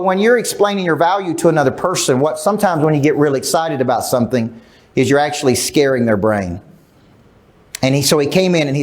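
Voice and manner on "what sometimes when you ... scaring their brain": ordinary talk, nothing marked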